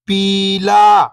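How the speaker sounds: whole word, level then falling at the end; syllables blended. Shown as a demonstration. Teacher showing beginners the joined word, syllables blended. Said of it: "इनको आपस में लाके पढ़ना है".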